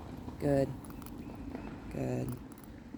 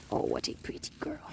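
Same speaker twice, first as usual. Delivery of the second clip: lower, hushed whisper of praise; 6 semitones lower, 8 dB quieter than usual. Real, not acted. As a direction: whisper softly to calm the dog.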